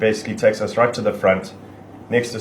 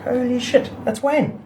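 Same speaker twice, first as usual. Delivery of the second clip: much higher, a mock gasp of disbelief; stunned surprise; slower than usual. Telling friends how he reacted on hearing of Wayne, high astonished voice. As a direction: replay the jolt of recognising a friend's name. Retelling his own shocked reaction.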